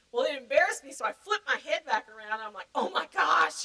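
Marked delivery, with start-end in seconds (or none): 2.7-3.6 high, loud "oh my gosh" outburst; mock mortified exclamation. Embarrassed teenager flipping her head back around, mock mortified.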